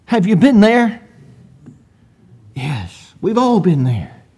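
usual speaking voice

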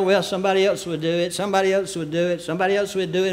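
quick, soft, repetitive patter; offhand buck-passing. Acted. Preacher quoting a poem on shirked work, offhand. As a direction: rattle it off quietly like a shrug.

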